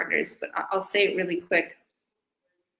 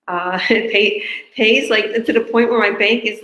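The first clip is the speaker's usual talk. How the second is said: louder, laughing through the words; laughing excitedly; 3 semitones higher, 9 dB louder, slower than usual. Real, not acted. Owner laughing to partners about big pays, loud and giddy. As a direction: giggle as you brag about the money. Giddy over huge payouts.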